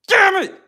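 high whiny mock cry; high mock sob; mock whine. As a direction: whine it like you're about to cry.

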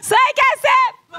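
very high, slow, loud shout; stretched stage shout. Pretend. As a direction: drag the words out for a cheering crowd. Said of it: "J'ai toujours rêvé de faire ça". (cri de scène étiré et très aigu)

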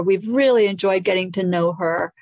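ordinary talk

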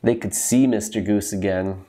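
plain reading voice

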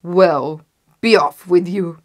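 loud, dismissive troll; roaring troll. A narrator's reading. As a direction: send them off with a loud troll voice. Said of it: "roared the troll".

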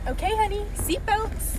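high, soft sugary mom; sweet mom voice. Acted. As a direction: coo it like a doting mother. Mom settling in the car.

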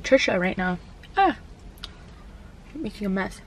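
talking while chewing; speaking between bites of food; mouth full. Real, not acted. Seen 3: holds sandwich, licks fingers, chewing between bites while speaking.

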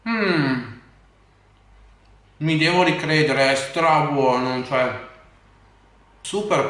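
chewing while talking; speaking between bites; mouth full. Real, not acted. Seen 1: Bite at -7, lips working and chewing through early words.

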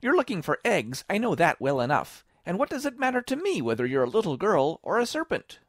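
high, loud bird voice; high-pitched suspicious challenge; accusing pigeon. A narrator's reading. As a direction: accuse angrily as an irate pigeon.

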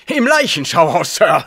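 a little too loud with eager joy; overjoyed and a bit too loud to Holmes; joyful, too loud. Acted. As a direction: blurt it out gladly and too loudly. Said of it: "rief er vor Freude ein wenig zu laut".

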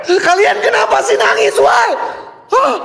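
high yelling question near tears; scared shout. Real, not acted. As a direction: yell at the crying voices in fear. Yelling at crying ghosts. (teriakan tinggi penuh ketakutan)

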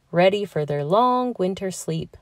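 usual speaking voice

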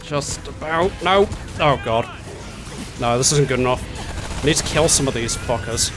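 ordinary delivery

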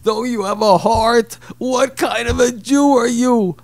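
high, loud, slow outraged yell; screaming. Retold or imitated. Walter screams at the young guest over his coldness. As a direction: scream it like a betrayed elder. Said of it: "starts screaming".

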